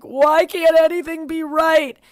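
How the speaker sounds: high, loud, slow mock cry; retold sobbing wail. To her friend after seeing the ruined tan, a high slow mock wail. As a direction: wail it as a half-acted breakdown. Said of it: "I just started sobbing".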